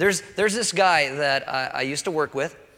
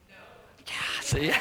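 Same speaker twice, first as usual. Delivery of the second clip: whispered aside; 5 dB quieter than usual. Real, not acted. Aside about coffee.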